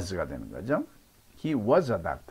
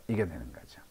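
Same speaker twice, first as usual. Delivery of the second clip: whispered aside; 7 semitones lower, 10 dB quieter, faster than usual. Real, not acted. Teacher aside after drill.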